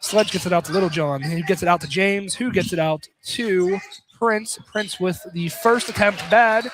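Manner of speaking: normal talking voice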